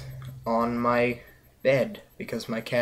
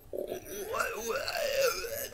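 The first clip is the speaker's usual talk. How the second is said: baffled cry; 7 dB quieter than usual. Retold or imitated. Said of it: "you're like".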